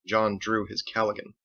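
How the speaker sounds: ordinary delivery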